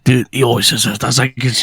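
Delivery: low laid-back dude voice; lightly voiced low-pitched dude; Charlie character voice.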